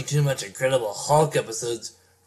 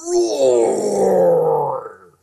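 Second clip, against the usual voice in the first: monster roar; 3 semitones higher, 5 dB louder than usual. Acted. Hulk roaring in battle.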